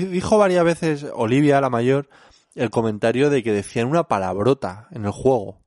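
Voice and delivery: ordinary talk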